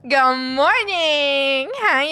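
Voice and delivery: loud, high, stretched-out good morning; big greeting. Real, not acted. Streamer greeting chat, loud and drawn out. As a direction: sing out the hello.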